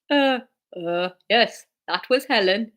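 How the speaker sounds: halting Geordie with hesitations; Geordie accent. Acted. Norman answers Jack about Helen, halting Geordie. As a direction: stumble into a Geordie reply.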